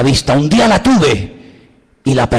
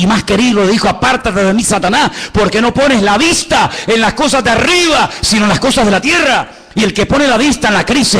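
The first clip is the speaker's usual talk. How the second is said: rising fervent sermon voice, ending higher; preaching; 5 semitones higher than usual. Acted. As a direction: preach it, building up. Preaching on looking at crisis. (predicación fervorosa que va subiendo)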